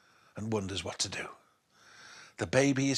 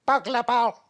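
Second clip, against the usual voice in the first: put-on high, loud toddler try at the name; baby voice; 10 semitones higher, 5 dB louder than usual. Baby repeating the burglar's name, high toddler voice. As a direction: say it like a small child.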